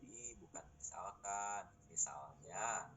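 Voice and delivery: soft, slow "Ih, bukan misalkan" correction; correcting reminder. Retold or imitated. Teacher retelling how he reminds a lecturer, quietly. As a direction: gently correct a colleague. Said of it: "suka diingetin".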